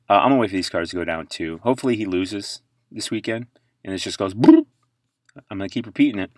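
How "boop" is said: very high loud boop; price drop noise. Pretend. Host voicing a card price falling, high loud boop. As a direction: make the value plunge with a sound.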